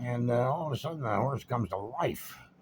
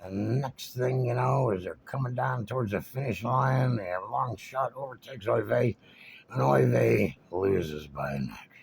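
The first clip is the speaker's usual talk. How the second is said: usual speaking voice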